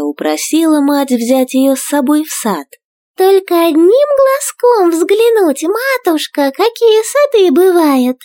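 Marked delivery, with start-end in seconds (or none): 3.2-8.2 high tiny princess voice, pleading; child begging. A narrator's reading. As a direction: beg sweetly like a little girl. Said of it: "упрашивала царевна Горошинка".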